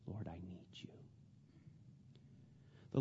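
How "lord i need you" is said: whispered prayer. Acted. Preacher whispering his plea to God. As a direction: whisper "Lord, I need you." unvoiced.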